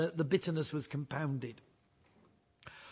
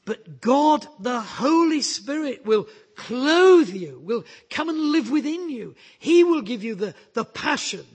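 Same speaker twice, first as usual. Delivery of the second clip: high, loud, rousing charge to go and tell; full-force preaching; 8 semitones higher, 8 dB louder than usual. Acted. Preacher voicing Jesus sending his disciples out, with rousing force. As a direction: preach it out like a commission.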